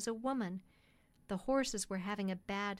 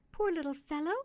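higher-pitched woman's voice; poor worried mother; 6 semitones higher, 3 dB louder than usual. Acted. Mother speaking of her sick little boy, high-pitched and pitying. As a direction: voice a humble country mother.

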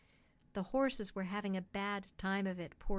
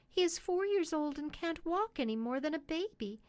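higher-pitched woman's voice; poor worried mother; 6 semitones higher than usual. Acted. Mother speaking of her sick little boy, high-pitched and pitying. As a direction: voice a humble country mother.

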